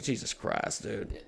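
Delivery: soft, voiceless exclamation; muttered exasperation. Retold or imitated. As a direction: swear under your breath at your kid. Exasperated dad under his breath.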